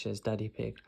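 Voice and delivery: ordinary delivery, nothing marked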